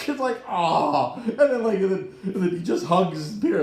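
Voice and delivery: giddy laughing retelling; laughing excitement. Real, not acted. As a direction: gush through giggles. Laughing gush about Spidey scene.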